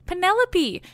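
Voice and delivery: high, bright call of her name; calling out with glee to wake her; excited exclaim. Acted. Nurse calling Penelope at her door, excited. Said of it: "Eurycleia exclaims excitedly".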